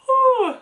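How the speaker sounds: high, loud shaky exhale through a laugh; teary whew. Real, not acted. Reviewer fighting tears over the book, high laughing whew. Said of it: "getting way too emotional".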